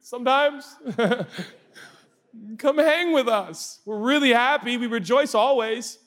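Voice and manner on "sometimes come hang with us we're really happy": high, coaxing reassurance; reassuring adults. Pretend. Adults comforting a newborn, high coaxing voice. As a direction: soothe the crying baby. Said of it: "we're like".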